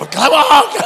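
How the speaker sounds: berro agudo e caricato; imitando o povo gritando. Retold or imitated. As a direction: berre mandando calar, exagerado. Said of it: "Tu viu o pessoal". (grito encenado de "cala a boca")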